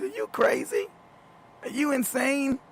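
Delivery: high, incredulous laughing question; laughing as he mocks the son's claim; laughing disbelief. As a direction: squeak it out in disbelief. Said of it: "I can't contain myself".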